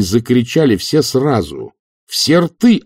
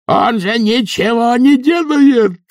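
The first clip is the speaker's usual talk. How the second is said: very high, slow shout; loud shout; 14 semitones higher, 4 dB louder, slower than usual. A narrator's reading. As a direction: stretch the yell out over the crowd, louder. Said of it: "прокричал Эфтерп". (протяжный громкий крик)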